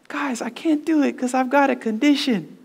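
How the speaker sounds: high-pitched mock pleading; pleading cousin. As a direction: imitate a distressed cousin making his excuse. Cousin pleading his excuse.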